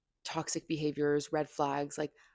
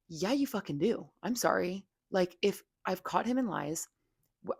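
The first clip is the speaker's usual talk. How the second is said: ordinary talk, nothing marked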